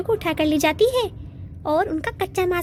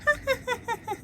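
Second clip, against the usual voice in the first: giggle; 6 semitones higher, 8 dB quieter than usual. Acted. Rosie laughing at phone.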